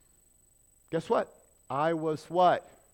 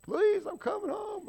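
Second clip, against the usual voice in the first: put-on much higher, strained old man's wail; Sanford impression. Preacher mimics the sitcom father, high comic wail. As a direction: ham up a sitcom dad faking a heart attack. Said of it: "Remember Sanford's son?".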